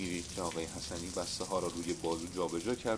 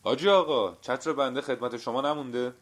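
raised voice, higher and louder; loud call; 5 semitones higher, 9 dB louder than usual. A narrator's reading. Man asking the grocer after his umbrella, loud. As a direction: call out loudly to be heard in the shop. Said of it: "با صدای بلند گفت".

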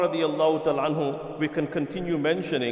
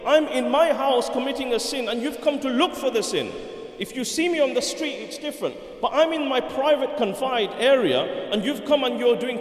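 indignant protest; 7 semitones higher than usual. Retold or imitated. Old man argues back in story.

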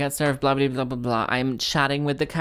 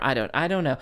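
ordinary talk, nothing marked

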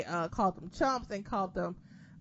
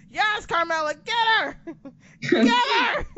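squealing, laughing cheer, much higher and louder; 11 semitones higher, 10 dB louder than usual. Retold or imitated. Fan cheering Carmella on in Twitter feud, shrieking with laughter. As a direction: egg her on like a screaming fan. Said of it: "I was like".

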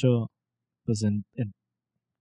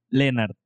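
Lenard with stress on the E; acento en la E. Shown as a demonstration. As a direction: stress the opening syllable of Lenard. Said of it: "como con acento en la E". (Lenard acentuado en la E)

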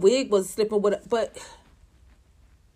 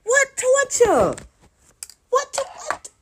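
outraged disbelief; 14 semitones higher, slower than usual. Real, not acted. Outraged mocking disbelief at testimony.

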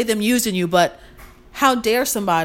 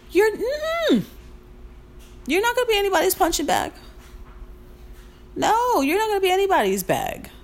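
sassy no; 11 semitones higher than usual. Real, not acted. Firm, emphatic refusal to viewers.

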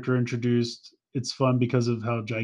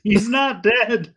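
high, drawn-out exasperated protest; mock protest; 9 semitones higher, 4 dB louder, much slower than usual. Fan objecting as Autobots mourn Skyfire, exasperated. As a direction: stretch it out high in laughing exasperation.

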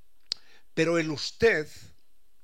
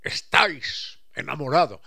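archaic vos; 8 dB louder than usual. Pretend. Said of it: "archaic vos".

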